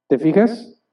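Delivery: ordinary talk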